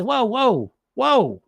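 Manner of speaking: slow, soothing inner coach; a calm coach settling a panicked mind. Retold or imitated. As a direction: ease yourself down gently. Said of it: "this one comes right and says".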